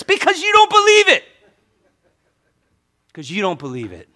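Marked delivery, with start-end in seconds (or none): 0.0-1.2 very high, loud, strained shout; full-force preacher's shout; yelling. Real, not acted. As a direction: yell the answer at the top of your range. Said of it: "Why are you yelling".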